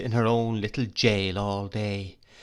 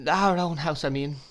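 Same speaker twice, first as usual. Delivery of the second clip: higher, hesitant correction; frightened faltering; 6 semitones higher than usual. Acted. Frightened wife correcting herself to her husband. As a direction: back down in fright. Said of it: "faltered my mother, evidently frightened".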